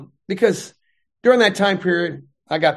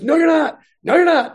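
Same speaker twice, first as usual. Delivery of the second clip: high, pressed mock alarm, repeated; insistent alarm; 9 semitones higher, 4 dB louder than usual. Nurse insisting to the patient, in high mock alarm. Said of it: "totally wigging out".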